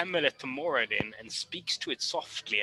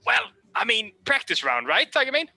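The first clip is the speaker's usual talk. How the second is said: teasing; 8 semitones higher, 4 dB louder than usual. Acted. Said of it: "You hear her go".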